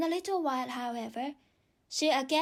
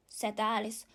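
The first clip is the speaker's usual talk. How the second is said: usual speaking voice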